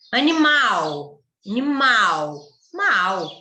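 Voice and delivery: "Animal" with a loud, stretched final MAL; slow, emphatic MAL at the end of "Animal"; stress on MAL. Shown as a demonstration. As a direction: hit and hold the last syllable of "Animal", then isolate "mal". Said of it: "É a sílaba tônica".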